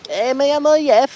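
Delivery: very high, loud mock-Spanish lilt; Spanish accent. Shown as a demonstration. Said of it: "I've got that good Spanish accent".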